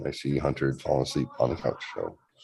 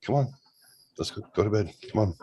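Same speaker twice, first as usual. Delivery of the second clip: put-on lifted, sing-song coaxing to a child; higher, coaxing him to bed; mock-sweet parent voice, pitched up; 6 semitones higher, faster than usual.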